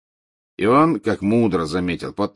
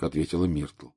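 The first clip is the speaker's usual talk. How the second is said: plain reading voice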